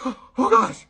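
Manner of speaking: loud, high gasping exclamation; startled retold outburst; shocked. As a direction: blurt it out in acted alarm, pitch leaping up. Acted shock after a gasp.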